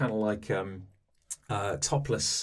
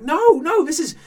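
much higher, louder shriek of no; shrieking denial; 11 dB louder than usual. Acted. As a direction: shriek it in panic. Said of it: "shrieking now".

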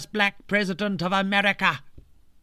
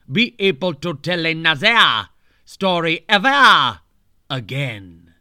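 menacing villain; 2 semitones lower, 5 dB louder than usual. Acted. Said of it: "Charles curses her".

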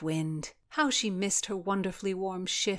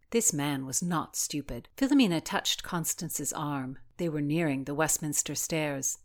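plain reading voice

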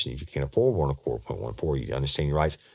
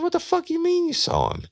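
very high-pitched retold disbelief; incredulous question. Detective challenging the suspect's claim, incredulous. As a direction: throw the question back in astonishment, voice way up.

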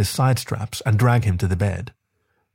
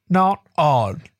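higher voice, words muffled by a full mouth; mumbled through a mouthful; 7 semitones higher than usual. A narrator's reading. Partner mumbles back with mouth full, muffled. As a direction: mumble it around something in the mouth. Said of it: "Then he mumbles".

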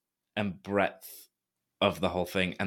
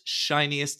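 ordinary talk, nothing marked

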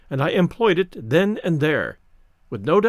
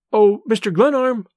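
a louder, higher cry of his name; 5 semitones higher, 5 dB louder than usual. A narrator's reading. Young woman calling out to the old man, loud. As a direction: call out to him in mock dismay. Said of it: "she cried".

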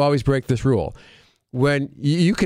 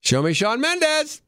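game show host; 9 semitones higher than usual. Acted. Game show host reveals answer.

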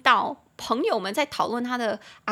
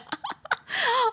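a sudden high laugh of disbelief; high burst of laughter. Real, not acted. Laughs at the chicken wings.

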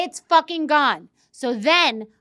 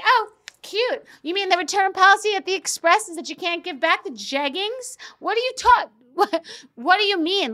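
higher, sarcastic mock-sweet disbelief; 7 semitones higher than usual. To her staff, mock-sweet sarcastic disbelief. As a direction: play it cute and cutting, then break into a laugh.

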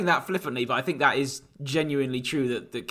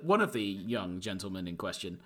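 normal talking voice

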